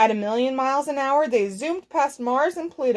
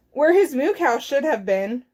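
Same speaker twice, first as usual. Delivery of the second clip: usual speaking voice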